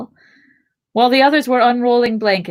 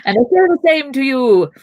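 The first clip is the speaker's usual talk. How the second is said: higher, louder playful comeback; mock retort; 7 semitones higher, 6 dB louder than usual. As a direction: throw it back loudly, hammed-up. Mock retort in retold exchange.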